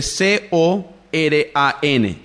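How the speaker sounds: slow, letter-by-letter spelling of C O R A N; spelled out. Shown as a demonstration. Teacher spells the book's name for listeners, spelled out. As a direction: spell it out slowly, one letter at a time. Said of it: "se escribe".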